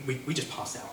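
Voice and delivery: usual speaking voice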